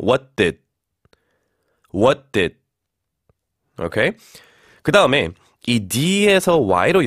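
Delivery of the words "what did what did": "What did" with no vowel after t or d; clean stopped endings. Shown as a demonstration. Teacher modelling "What did" for repetition, stopped final consonants. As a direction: end "What did" right on an unreleased d. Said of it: "이렇게 한번 해볼게요".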